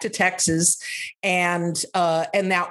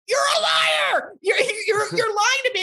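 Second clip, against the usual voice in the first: furious shout. Retold or imitated. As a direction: scream it at her on the street. Shouting at roommate on street.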